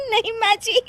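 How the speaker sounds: high excuse collapsing into a laughing fit; helpless laughing. Acted. Daughter-in-law attempts an excuse to mother-in-law, laughing helplessly. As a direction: try to explain, then lose it. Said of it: "फिर से हंस रही हो".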